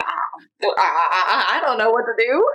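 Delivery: laughing through helpless words; flustered laugh-cry. Retold or imitated. Mother recalling being stumped by her son, laughing.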